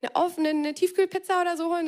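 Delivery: high-pitched, breezy imposition; cheeky stranger. Acted. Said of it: "die Person sagt".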